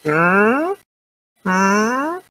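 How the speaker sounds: put-on high, slow old man voice; little old voice. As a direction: drag it out like a deaf old man. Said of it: "leur petite voix de vieux".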